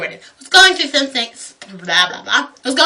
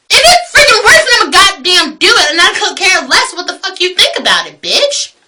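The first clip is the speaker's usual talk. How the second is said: high, loud burst of indignation; defiant rant; 5 semitones higher, 3 dB louder than usual. Retold or imitated. Defending her loud consoling to old lady, defiant rant. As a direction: burst out high, then lift the last word.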